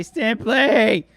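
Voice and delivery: loud, high and excited; shouted birthday cheer. Real, not acted. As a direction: yell it out across the room. Shouting birthday wishes to friend.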